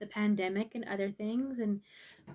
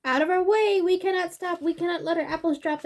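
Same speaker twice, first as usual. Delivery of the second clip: higher, louder urgent character voice; storybook animal voice; 6 semitones higher, 6 dB louder than usual. Acted. Animals pushing past the baby bear, high and urgent. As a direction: push through in a rush.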